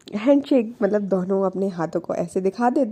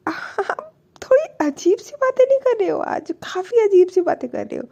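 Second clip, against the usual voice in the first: much higher, mock-indignant voice; retold indignant protest; 9 semitones higher than usual. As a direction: act out a put-out complaint, pitched high. Acted protest in texting quarrel.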